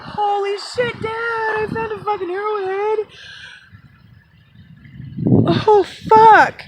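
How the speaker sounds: overwhelmed. Real, not acted. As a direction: build to a joyful cry. Renewed awe at the arrowhead.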